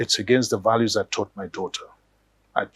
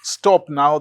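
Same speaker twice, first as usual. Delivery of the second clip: exasperated shout; 16 dB louder, slower than usual. Acted. Husband loses patience, yells.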